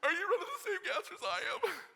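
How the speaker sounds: high, laughing incredulous cry; laughing disbelief. Retold or imitated. Exhausted freshman laughing in disbelief at the captains. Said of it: "I'm like".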